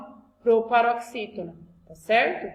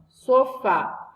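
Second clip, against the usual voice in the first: Sofá with FÁ punched louder; teacher showing an oxítona; stress on the last syllable; 3 semitones higher, 6 dB louder than usual. Shown as a demonstration. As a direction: hit the final FÁ of Sofá. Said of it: "a última aqui".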